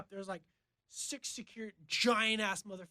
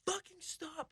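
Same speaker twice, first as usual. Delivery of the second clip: high, hushed mock scream; retold scream; 9 semitones higher, 9 dB quieter, slower than usual. Man pinned against a fence by guards, mock screaming. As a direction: imitate screaming without the volume. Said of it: "and I'm screaming".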